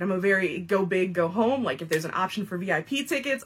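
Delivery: usual speaking voice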